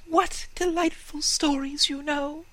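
high, tiny mouse voice; squeaky little mouse. Acted. Mice speak in squeaky voice.

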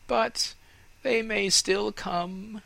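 high, loud, hopeful tree voice; hopeful fir tree. Acted. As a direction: cry out the hope. Tree hopes aloud.

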